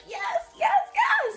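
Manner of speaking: gleeful yes, yes, yes with a laugh; excited yes. Real, not acted. Girl cheering about getting her nails done.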